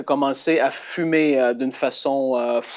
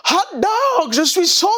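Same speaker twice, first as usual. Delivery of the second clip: much higher jubilant shout; ecstatic cry. Retold or imitated. Boy walking home shouting his joy, much higher. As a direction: crow it over and over. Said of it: "il disait".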